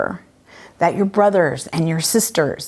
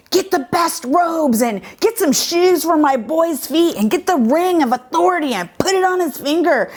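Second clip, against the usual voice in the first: mock calling out orders, pitched up; 8 semitones higher than usual. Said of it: "he calls out to his servants".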